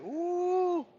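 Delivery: long, high-pitched excited ooh; retold hungry delight in a high ooh. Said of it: "And I was like".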